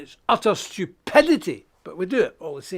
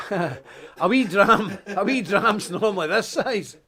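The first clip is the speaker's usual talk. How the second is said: chuckling through the words; laughing; 3 semitones higher, slower than usual. Real, not acted. Preacher joking with viewers about a wee dram, laughing. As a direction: laugh as you show the size.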